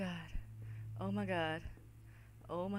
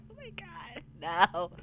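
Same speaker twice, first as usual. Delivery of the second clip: ordinary talk, nothing marked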